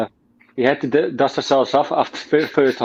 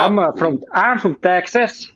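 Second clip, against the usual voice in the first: hammed-up Texas drawl, higher and stretched out; mock American accent; 4 semitones higher, slower than usual. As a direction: put on a silly Texan accent. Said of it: "try an American accent".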